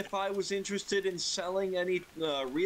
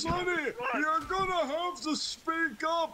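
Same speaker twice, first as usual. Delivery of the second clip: put-on old man voice; 9 semitones higher than usual. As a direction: quaver it out like a high-pitched old geezer. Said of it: "puts on his best old man voice".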